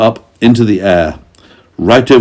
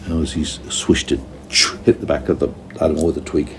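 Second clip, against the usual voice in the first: ordinary delivery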